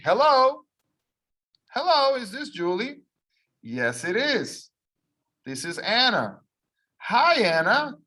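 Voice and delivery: clear, lively model reading of a phone call; teacher modelling a phone call; dialogue read for repeating. Shown as a demonstration. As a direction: read both parts for learners to copy.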